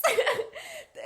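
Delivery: high, bright burst of laughter; high giggle. Real, not acted. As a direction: giggle at her own fluster. Laughing at herself.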